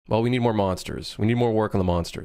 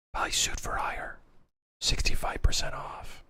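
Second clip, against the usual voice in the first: creepy whisper; 6 dB quieter than usual. Pretend. Inner voice urging players to buy his game, whispering. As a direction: whisper it like a voice in your head.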